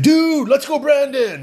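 high and bright with delight, imitating the groom; excited reply. Podcaster imitates the groom answering him, excited high voice. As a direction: mimic the groom's thrilled recognition.

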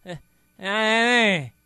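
half-hearted drawn-out call; ineffectual parent. Acted. Host imitating lax parents, feeble drawn-out scolding. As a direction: stretch a feeble call to the child. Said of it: "los papás es".

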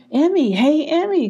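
high, singled-out name call. A narrator's reading. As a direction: call to a friend running by. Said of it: "called Rose".

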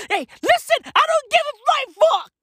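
shrill-pitched hammed-up yelling; high manic rant about not caring; high frantic rant. Ranting, voice jumps high.

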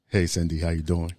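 low, smooth late-night radio host; imitating K-Dot. Co-host teasing K-Dot's greeting to a guest, low mock smooth voice. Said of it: "I put on the Quiet Storm".

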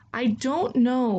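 put-on a girl's mild wail of despair. Chiyoko to the old man, a slow wailing cry. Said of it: "wails Chiyoko".

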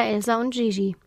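ordinary delivery

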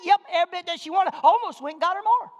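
loud, high, proud comeback; defiant triumph. Retold or imitated. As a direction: shout it back proudly. Triumphant answer shouted to congregation.

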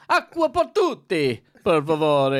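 high, loud hammed-up Italian accent; exaggerated Italian restaurant flourish; mock Italian ordering. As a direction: call out the order in showy Italian. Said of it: "order in that language".

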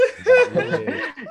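very high squealing laugh; shrieking laugh. Real, not acted. Laughs at joke.

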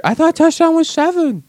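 very high, silly mock voice; clueless novice. Clueless viewer questioning the score, high mock voice. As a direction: pipe up as a confused newcomer.

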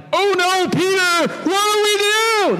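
very high hammed-up panic; mock freak-out. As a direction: wail in pretend helpless alarm. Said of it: "Is Jesus freaking out in the storm".

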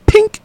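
squeaky high-pitched pink; high bottle pink sound. Retold or imitated. Telling friends about the table, squeaky high imitation. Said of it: "that's the only noise you could hear".

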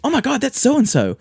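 high, loud gushing imitation; mocking gushing couple. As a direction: parody an excited couple spotting actors. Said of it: "they were like".

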